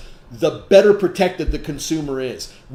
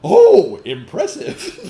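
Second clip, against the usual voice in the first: squealed high, loud "Oh" dropping to a laughing "impressive"; mock being impressed, then laugh it off; hammed-up fake admiration; sarcastic "impressed"; 11 semitones higher, 7 dB louder, much slower than usual. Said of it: "a lot of people would be like".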